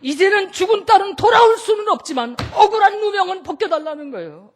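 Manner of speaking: high, loud preaching cry; ringing sermon shout; preaching at full force. Acted. Preacher shouting mother's plea.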